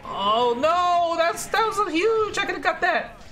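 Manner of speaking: high-pitched wail of regret; anguished outcry. Real, not acted. Gamer who missed a huge chance, anguished outcry. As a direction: climb high in dismay.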